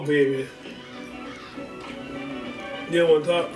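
ordinary talk, nothing marked

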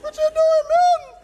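loud falsetto shriek; hammed-up panicked squeal at full volume; squeaky high voice. Pastor shrieking at his trainer to explain himself, very high and loud. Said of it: "My voice starts getting high like".

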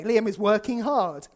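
ordinary talk

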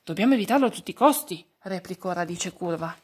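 plain reading voice

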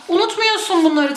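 ordinary talk, nothing marked

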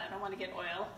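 ordinary delivery

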